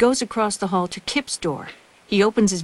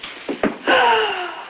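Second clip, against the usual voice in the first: sharp high frightened gasp; gasp of fright. Acted. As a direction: gasp as the gun appears. Gun appears in the doorway.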